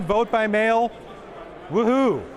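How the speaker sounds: ordinary delivery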